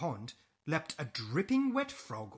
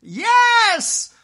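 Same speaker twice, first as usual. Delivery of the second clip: high, very loud "yes"; elated storybook witch; joyful cry; 14 dB louder than usual. Acted. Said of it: "cried the witch".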